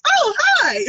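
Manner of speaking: gushing greeting. Retold or imitated. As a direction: squeal it like meeting someone charming. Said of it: "they're like".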